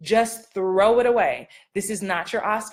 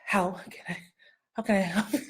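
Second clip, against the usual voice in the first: softer, heavy, over-weighted read; mock deep; 6 dB quieter, faster than usual. Shown as a demonstration. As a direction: lean on it like it's your big scene. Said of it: "They wouldn't be like".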